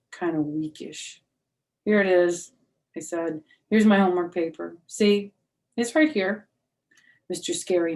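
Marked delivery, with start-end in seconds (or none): 1.9-6.5 put-on louder, eager little-girl insisting; nervous child. Junie B overselling her copied homework to the teacher, louder. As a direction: wave it about a bit too eagerly.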